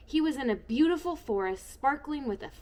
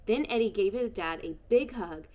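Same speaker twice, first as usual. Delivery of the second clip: ordinary delivery, nothing marked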